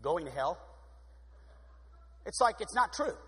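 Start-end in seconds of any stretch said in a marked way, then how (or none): 0.0-0.6 low, soft, doom-laden; a hint of a miserable churchgoer; mock gloomy singer. Shown as a demonstration. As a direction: sound like a man headed for hell. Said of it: "you ever seen somebody sing".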